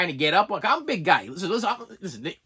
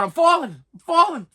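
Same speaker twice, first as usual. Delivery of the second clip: falling, falling, getting louder each time; building yell; 9 semitones higher, 5 dB louder than usual. Retold or imitated. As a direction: start calling out and build to a full shout. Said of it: "I'm getting louder as I'm falling".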